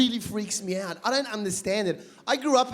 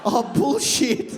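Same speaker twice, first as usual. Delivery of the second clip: slow, louder, laughing disbelief; disbelieving disciple; 5 semitones higher, 5 dB louder, much slower than usual. Retold or imitated. Disciple hearing the news, slow laughing disbelief. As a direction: drag out the disbelief. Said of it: "disciples wake up going".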